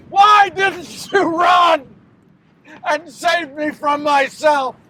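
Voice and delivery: high, loud, desperate pleading; anguished outcry. Acted. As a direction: cry out in torment, drawing out the words. Judas anguished that Jesus didn't flee.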